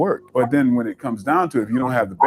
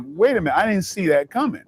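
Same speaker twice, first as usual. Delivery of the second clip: retold surprise; 7 semitones higher than usual. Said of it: "he kinda freaked out".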